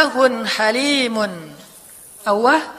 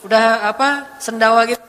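ordinary talk, nothing marked

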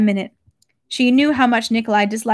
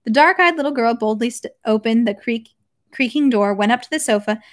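ordinary delivery, nothing marked

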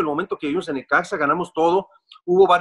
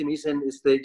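ordinary delivery

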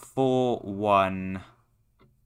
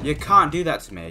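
raised, higher indignant complaint; loud protest; 5 semitones higher, 5 dB louder than usual. Real, not acted. Complaining at the game that scared him, raised and loud.

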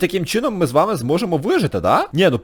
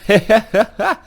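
normal talking voice

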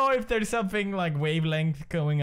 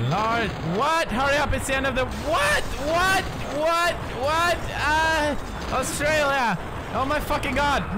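high, loud, frantic bursts; panicked shouting; 11 semitones higher, 3 dB louder than usual. Real, not acted. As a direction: shout as the clock runs out. Panics at timed quiz question.

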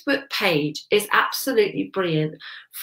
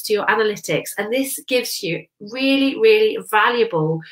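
ordinary talk

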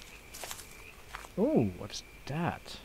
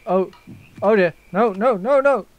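high, loud burst of alarm; rising alarm, loud and high-pitched; panicked reaction; 8 semitones higher, 10 dB louder than usual. Real, not acted. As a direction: panic as the danger closes in. Panic as game threat appears.

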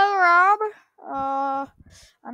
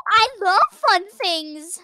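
excited; 7 semitones higher, 6 dB louder, slower than usual. Acted. Gasp of delight at fun.

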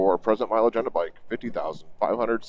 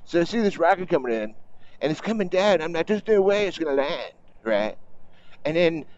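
higher, hammed-up Trump storytelling voice; Trump impersonation; 6 semitones higher than usual. Biker retelling the acceptance speech, in a higher hammed-up Trump imitation. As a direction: ham up his bragging like a rally speech. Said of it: "I can't do an impersonation of anybody".

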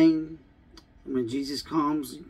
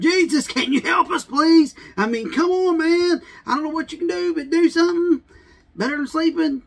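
high, loud frantic begging; desperate pleading; 13 semitones higher, 6 dB louder than usual. Acted. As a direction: shake him awake, pleading for help. Disciples begging Jesus awake.

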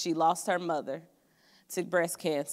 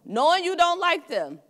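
much higher, louder knowing jab; animated emphasis; 11 semitones higher, 7 dB louder than usual. Real, not acted. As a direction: throw it up high like a punchline. Sermon aside, knowing wink.

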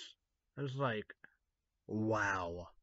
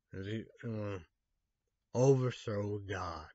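ordinary talk, nothing marked

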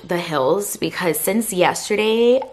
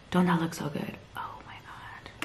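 hushed whisper over the food; 12 dB quieter than usual. Real, not acted. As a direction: breathe it out in a whisper.

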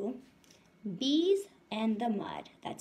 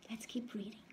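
whisper; 8 dB quieter than usual. Real, not acted. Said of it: "whispered to class".

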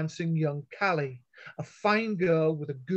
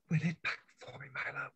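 whisper; 2 semitones lower, 9 dB quieter than usual. A narrator's reading. Callie whispering to Milo as she hands over the ring. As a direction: breathe it close to his ear. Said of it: "she whispered".

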